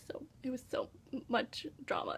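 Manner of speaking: soft whispered aside; whispered exasperation. Real, not acted. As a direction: drop to a hush, overwhelmed. Aside about the drama.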